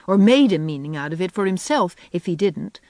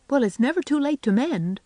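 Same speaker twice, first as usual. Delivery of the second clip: higher, teasing lilt; roguish; 8 semitones higher than usual. A narrator's reading. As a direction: tease her with playful mischief. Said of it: "said Anne roguishly".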